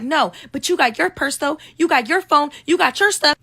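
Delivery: mock her jealous jab, pitch climbing; high mimicked whine rising to a shout; accusing friend. Friend accuses narrator in argument.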